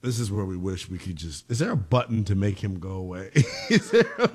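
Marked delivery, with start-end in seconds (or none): 3.3-4.4 high-pitched laughter breaking through; laughing. Real, not acted. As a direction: crack up mid-question. Cracking up at co-host.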